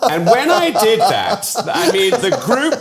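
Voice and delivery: helpless laughter breaking up the words; laughing. Real, not acted. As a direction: tell it through high-pitched giggles. Interviewee laughing while telling charades story.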